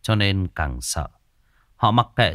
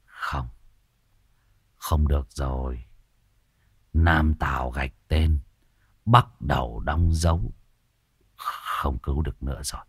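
low, soft, grave verdict; hopeless old healer; 7 semitones lower, 3 dB quieter than usual. A narrator's reading. Healer telling the crowd the dead man is beyond saving, low and grave.

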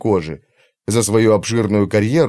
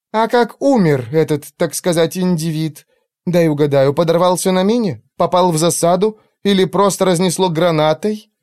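sarcastic; 7 semitones higher than usual. Acted. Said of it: "язвительно поинтересовалась Вонг".

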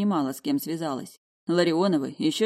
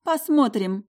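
cheerful; 6 semitones higher, 4 dB louder, slower than usual. A narrator's reading. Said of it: "весело заявила".

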